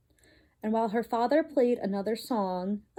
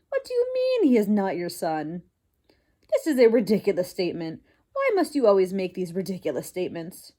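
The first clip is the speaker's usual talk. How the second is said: fast, lower exasperated scolding; impatient scolding wife; 2 semitones lower, 3 dB louder, faster than usual. Acted. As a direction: rattle it off, fed up with him.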